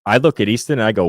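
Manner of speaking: ordinary delivery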